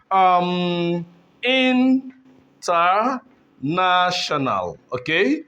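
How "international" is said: "international" very slowly, in, ter, na, tional, long gaps; teacher slowly breaking up "international"; syllable by syllable. Shown as a demonstration. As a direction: stretch and separate the syllables of "international". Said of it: "words that are five syllable here".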